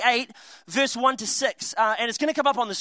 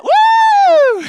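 high, loud whoop; loud joyful woo; cheering woo; 12 dB louder than usual. Retold or imitated. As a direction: whoop it out like a thrilled kid. Family cheering on stormy deck.

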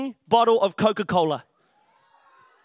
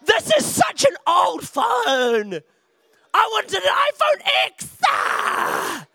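high, slow, hammed-up whining shout; bratty whine; 7 semitones higher, much slower than usual. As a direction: whine like a spoiled kid to mum. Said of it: "and you're like".

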